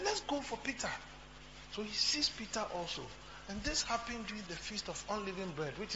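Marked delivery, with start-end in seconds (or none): 0.0-0.9 high, soft voice of the plotting devil; scheming Satan. Acted. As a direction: play Satan picking his next target, pitched high. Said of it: "So he said".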